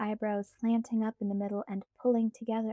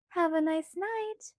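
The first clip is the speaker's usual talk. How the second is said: put-on light, higher girlish pleading voice; Alice's voice; 8 semitones higher, slower than usual. A narrator's reading. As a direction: voice Alice higher, coaxing Bella. Alice farewell.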